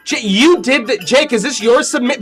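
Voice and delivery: ordinary talk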